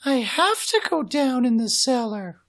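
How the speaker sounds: brisk, unworried mom; mother's voice. Acted.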